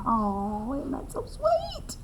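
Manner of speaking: high, soft cooing over something cute; tender coo. Acted. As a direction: melt over the sweet picture.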